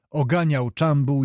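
plain reading voice

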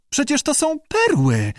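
very high amazed exclamation; choked out. A narrator's reading. Lee realises she holds real pearls, choking it out. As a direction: blurt it out high in astonishment. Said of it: "wykrztusiła".

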